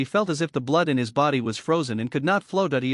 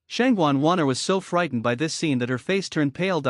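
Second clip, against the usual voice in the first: plain reading voice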